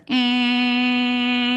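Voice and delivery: high, flat held horn drone; imitated car horn. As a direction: hold one flat honk like a stuck car horn.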